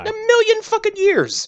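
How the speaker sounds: high, strained squeal; mock shriek. Cohost joking nobody would mess with him, high mock squeal. Joking about looking tough.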